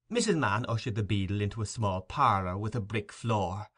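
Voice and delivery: ordinary delivery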